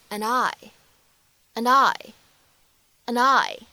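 "and I" run together as "an-nai"; linked words. Shown as a demonstration. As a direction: link "and I" smoothly, dropping the d. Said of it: "咱们可以做一个连读". (“and I”连读成“an-nai”)

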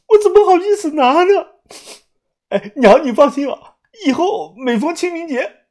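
high, loud, fast hammed-up wailing; mock-tearful lament to a dead "mother"; loud wailing cry. A narrator's reading. Douya wailing thanks to the old woman over the cash, hammed-up and high. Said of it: "哭得大声地说呀".

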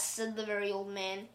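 plain reading voice

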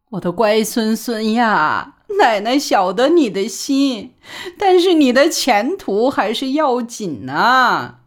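put-on a mild teary old grandmother, slow and pleading; tearful grandma. A narrator's reading. Grandmother hugging grandson and weeping, mild tearful plea. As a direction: plead gently through light tears. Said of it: "抱着小宝的脑袋就哭".

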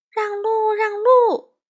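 high, building loud cry; pushing the cart, shouting for everyone to move; shouting to clear the way. A narrator's reading. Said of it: "他高声叫道".

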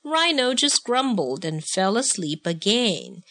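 ordinary delivery, nothing marked

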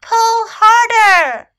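high, very loud, drawn-out urging; shouting. A narrator's reading. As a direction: shout it long, straining for effort. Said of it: "shouted Snake".

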